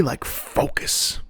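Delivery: whisper. Shown as a demonstration. Whispered word.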